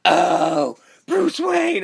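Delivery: high, loud "oh" into a squealed name; hammed-up swooning fangirl shriek; mock gushing squeal. Retold gushing squeal at seeing Bruce.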